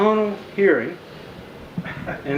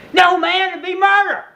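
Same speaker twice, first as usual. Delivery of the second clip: high loud pleading yell; stopping partner from murder, desperate high shout; 13 semitones higher, 5 dB louder than usual. Retold or imitated. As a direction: scream it to stop him.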